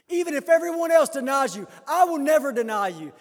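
loud, high, impassioned declaration; preacher acting out a bold pledge; vehement vow. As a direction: cry it out as Peter's boast. Voices Peter's vehement boast.

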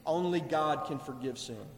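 low, soft, knowing statement; understated and assured, dropped in pitch; calm agreement. Acted. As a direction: say it quietly, sitting back, as confirmation. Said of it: "Jesus is sitting back and saying".

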